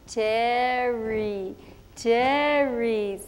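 "Terry, Terry" drawn out with an English r; teacher drilling "Terry" with a slow American r; slow English name. Retold or imitated. Teacher drilling Tere on her name, "Terry" slow and English. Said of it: "Watch my mouth".

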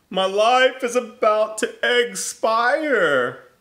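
high, loud, slow hammed-up blubbering; a melodramatic weepy wail. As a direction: sob out the doom. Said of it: "blubbered Lance".

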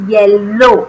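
येलो said slowly with a doubled l; clear model word. Shown as a demonstration. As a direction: say येलो as yel-lo with a held l. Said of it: "पहला शब्द है". (येलो को साफ़ और धीरे बोलें)

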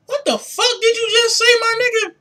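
high-pitched disbelief; baffled. Real, not acted. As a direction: squeal it out in total confusion. Baffled by the rep's reply.

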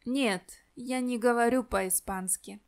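ordinary talk

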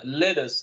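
"Lettuce" with a flapped t, like "ledus", falling; corrected model. Shown as a demonstration. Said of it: "No, no, no".

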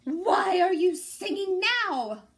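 furious mayor growling at the rooster. A narrator's reading. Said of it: "growled Don Pepe".

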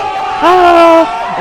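high, loud held "aah" like a choir; mock choir cry. Streamer adding the choir's reaction, mock choir cry. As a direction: belt out a joking gospel-choir swell. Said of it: "Un coro de negros".

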